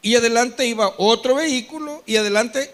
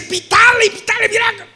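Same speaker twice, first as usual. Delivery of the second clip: high, loud mock urging; 10 semitones higher, 6 dB louder than usual. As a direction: push him to honk, loud and high. Son urging to honk.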